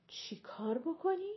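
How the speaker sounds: high, rising disbelief; incredulous question. A narrator's reading. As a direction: ask it as if you can't believe your ears. ماه منیر staggered by marriage announcement.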